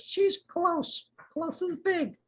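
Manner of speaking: very high, small squeak; tiny plant voice. Acted. A second plant remarking on the gardener, very high. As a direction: pitch it way up and small. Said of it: "another voice that goes".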